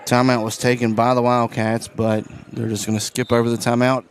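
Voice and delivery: play-by-play. Acted. Announcer calling the late fourth quarter, livelier play-by-play. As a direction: ride the action up.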